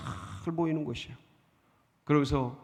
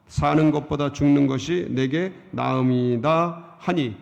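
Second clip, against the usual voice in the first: steady, measured Bible reading voice; scripture reading; 5 semitones lower, 4 dB louder than usual. Acted. Preacher reading Jonah's prayer to the congregation, measured scripture voice.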